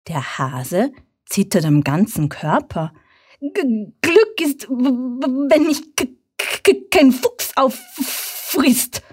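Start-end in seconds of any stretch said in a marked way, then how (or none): 3.4-9.0 high, trembling, halting stammer; frightened stutter. A narrator's reading. Trembling hare stammers his answer to the fox. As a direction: stutter in terror, slow and broken. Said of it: "stottert er".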